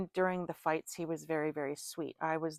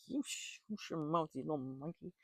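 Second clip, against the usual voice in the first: hushed scold; 2 semitones lower, 9 dB quieter than usual. Retold or imitated. Scolding son in car.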